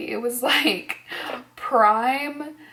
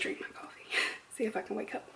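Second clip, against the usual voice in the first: whisper; 11 dB quieter, faster than usual. Real, not acted. Sleepy morning coffee aside.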